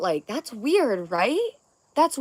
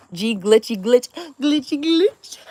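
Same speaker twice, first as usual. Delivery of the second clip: silly voice; 4 semitones higher, 7 dB louder, slower than usual. Acted. Reading a chat name aloud.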